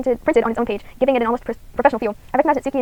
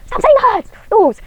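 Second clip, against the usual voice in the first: much higher and louder yell; frustrated shout; 10 semitones higher, 12 dB louder, much slower than usual. Real, not acted. Streamer snapping at the repeated game line, loud frustrated yell. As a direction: burst out and cut the line off.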